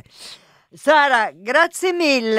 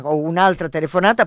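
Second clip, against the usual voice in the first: ordinary talk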